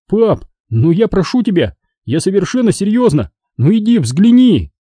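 high, urgent begging; insistent pleading. A narrator's reading. Boy begs father to look outside, insistent pleading. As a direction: keep pestering until he gives in. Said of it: "не унимался я".

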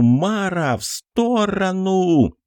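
high drawn-out desperate shout; wild yell. Acted. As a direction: yell it slow and long as a warning. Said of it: "Диким криком заорал Кокос".

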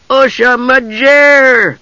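very high, drawn-out excited squeal; excited cry. A narrator's reading. Tass overjoyed at learning Usha's name, excited high cry. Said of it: "cried Tass".

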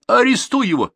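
high, urgent command; shouted order. A narrator's reading. Official ordering the policeman to arrest, loud and high. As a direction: order it at the top of the voice. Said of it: "и участковому приказал".

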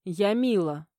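plain reading voice

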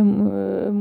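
usual speaking voice

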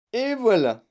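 loud, high triumphant reveal; cri triomphal. A narrator's reading. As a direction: crow it like a showman. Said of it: "cria-t-il triomphalement". (s'exclamer triomphalement, voix forte)

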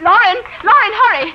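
high-pitched, urgent summons; urgent calling. Acted. Mother summoning Lauren to stop the escaping guest, urgent calling. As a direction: call for help in a hurry.

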